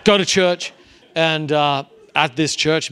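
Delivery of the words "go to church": eager compliance. Retold or imitated. Repeated going to church.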